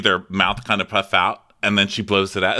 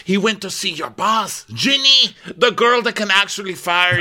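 mock urgent warning; 9 semitones higher, 5 dB louder than usual. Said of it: "And she's like".

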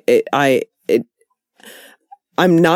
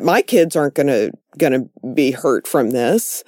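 normal talking voice

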